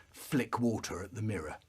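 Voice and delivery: ordinary talk, nothing marked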